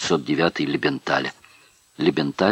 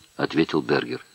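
plain reading voice

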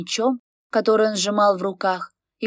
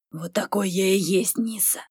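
guttural; 3 dB quieter than usual. A narrator's reading. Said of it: "гортанно сказал Дессер".

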